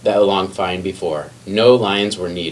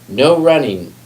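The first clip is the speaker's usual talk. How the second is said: higher, louder reminder; calling after; 6 semitones higher, 5 dB louder, slower than usual. A narrator's reading. Librarian calling after the running lion, louder reminder. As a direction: call the rule after him down the hall. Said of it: "Miss Merriweather called after him".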